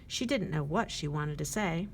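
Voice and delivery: plain reading voice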